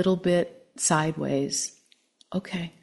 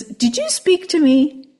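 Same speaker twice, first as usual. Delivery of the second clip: put-on much higher, startled old man; embarrassed man; 9 semitones higher, 7 dB louder than usual. A narrator's reading. Old man startled to hear a frog, higher. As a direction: ask the frog in disbelief. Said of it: "but he says".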